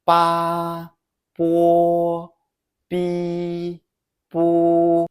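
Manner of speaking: "Ba, bo, bi, bu" in drawn-out first tone; syllable drill. Shown as a demonstration. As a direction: sustain "Ba, bo, bi, bu" evenly, pausing between each. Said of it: "Let's practice syllables".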